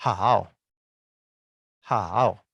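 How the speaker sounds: hao drawn out, dipping low then rising; full third tone. Shown as a demonstration. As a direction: say hao with the whole dip and climb.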